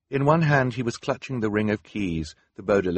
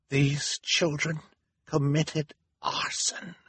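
slow, higher character voice with pauses; grave accusing Hal; 8 semitones higher, slower than usual. A narrator's reading. Hal turning to the audience, gravely accusing. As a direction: announce it haltingly, word by word.

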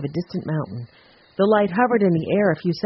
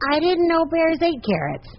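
put-on high-pitched, innocent little boy; small boy chiming in, pitched way up; little boy's voice; 11 semitones higher than usual. A narrator's reading. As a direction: pipe up high like a puzzled child. Young Benny's joke in story.

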